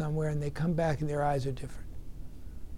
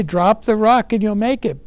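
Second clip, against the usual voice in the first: loud, higher shout; calling out; 5 semitones higher, 10 dB louder than usual. Retold or imitated. Members shouting down to the drowning man, urging. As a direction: call back down to the water. Boat crew calling back.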